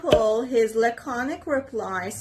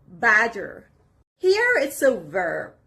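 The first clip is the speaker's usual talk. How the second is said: ordinary talk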